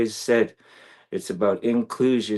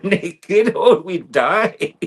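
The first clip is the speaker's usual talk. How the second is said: laughing; 9 semitones higher, 6 dB louder, much slower than usual. Real, not acted. Laughing at own joke.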